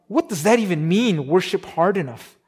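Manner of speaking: normal talking voice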